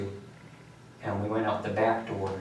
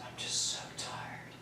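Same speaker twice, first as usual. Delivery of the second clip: whispered and spent, much softer; exhausted whisper; 8 dB quieter than usual. Retold or imitated. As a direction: barely voice it, drained. Exhausted after the fight.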